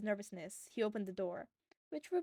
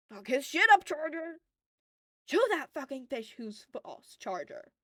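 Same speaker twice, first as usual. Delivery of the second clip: loud, rough cheering for Charger; rowdy fan yelling; 5 semitones higher, 9 dB louder than usual. A narrator's reading. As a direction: bellow it over the crowd.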